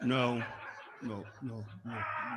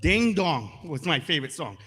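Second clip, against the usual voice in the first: ordinary delivery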